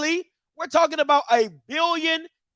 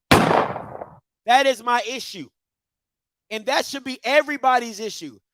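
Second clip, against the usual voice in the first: ordinary talk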